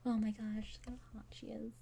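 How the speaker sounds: whispered aside, breathless with admiration. Retold or imitated. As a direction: whisper it to yourself as if he might hear. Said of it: "I was just like".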